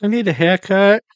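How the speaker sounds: high, loud doll voice; talking doll. Pretend. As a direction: play the creepy little doll. Voicing the haunted doll.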